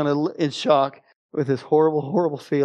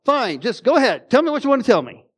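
higher, louder irritated outburst giving up the floor; fed-up retold yell; exasperated yell; 7 semitones higher, 3 dB louder than usual. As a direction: throw up your hands and shout it. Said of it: "I just yell".

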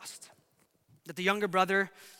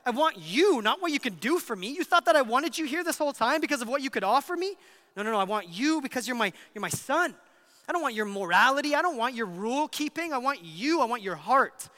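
earnest, higher-pitched fatherly plea; pleading father; 5 semitones higher, 5 dB louder than usual. Acted. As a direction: entreat a son to believe he is wanted. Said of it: "he pleads with him".

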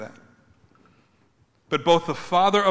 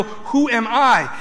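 sincere wonder; 7 semitones higher, 5 dB louder, much slower than usual. Retold or imitated. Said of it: "this is a real".

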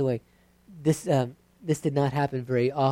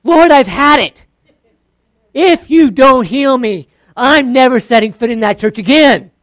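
loud, high angry ultimatum; preacher mocking an angry ultimatum, shouting high; ultimatum prayer; 7 semitones higher, 14 dB louder than usual. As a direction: threaten God, loud and fed up. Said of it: "You might even have the ultimatum".

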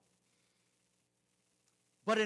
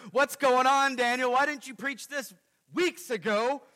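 loud, higher, indignant challenge to the preacher; mock accusing question; 5 semitones higher, 8 dB louder than usual. Congregant impatiently demanding why Daniel delayed the sermon, loud and indignant. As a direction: play the impatient congregant calling him out. Imagined listeners judging the preacher.

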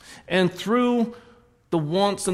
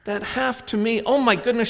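building excitement; 8 semitones higher, 4 dB louder than usual. Acted. Story climax, animated telling.

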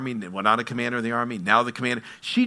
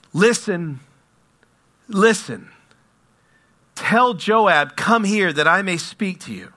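raised, higher-pitched call from the city; 4 semitones higher, 4 dB louder, slower than usual. A narrator's reading. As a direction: call out for attention, pausing after each listen. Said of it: "called out from the city".

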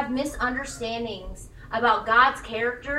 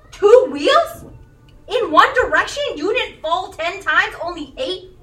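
higher, louder gushing amazement; amazed cheering; 4 semitones higher, 9 dB louder than usual. Pretend. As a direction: gush over a tiny win. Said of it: "We cheer our children on".

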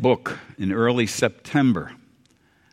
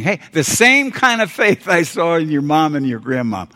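higher, louder, voicing Paul to Timothy; emphatic acted quote; 6 semitones higher, 6 dB louder than usual. Preacher as Paul encouraging Timothy about family faith, raised emphatic voice. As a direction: call it out with eager conviction. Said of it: "He was saying".

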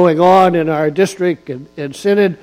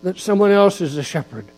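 louder emphatic preaching with punched words; 3 semitones higher, 4 dB louder than usual. Acted. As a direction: drive each phrase home to the pews. Preaches on Psalm 23.

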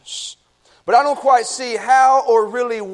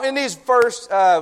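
ordinary delivery

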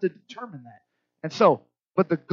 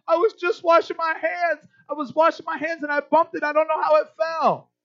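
put-on lightly raised little-boy voice; child's excuse; 9 semitones higher, 3 dB louder than usual. Father voices his son's innocent excuse in mild kid voice. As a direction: plead it like a kid caught out. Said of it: "He said".

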